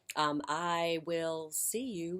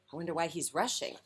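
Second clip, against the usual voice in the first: plain reading voice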